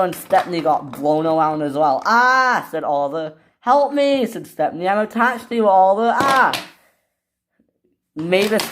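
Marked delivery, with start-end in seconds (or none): 2.1-2.7 high, loud "ah!"; short high yell of alarm; acted scream. Engine blown around by wind. 3.6-4.2 high-pitched call for help; acted plea, pitched way up; cry for help. Engine blown around calls for help. 4.9-6.5 alarmed. Acted. Engine blown away with Oliver.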